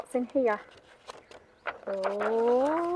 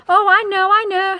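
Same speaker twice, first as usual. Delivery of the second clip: eager realisation; 8 semitones higher, 11 dB louder than usual. Acted. Seahorse eagerly guesses the answer.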